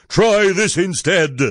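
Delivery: high, forceful retort; yell back. A narrator's reading. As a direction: yell it back as a fierce prince. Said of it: "the prince yelled back".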